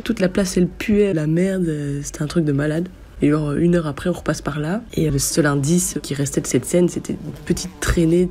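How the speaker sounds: ordinary delivery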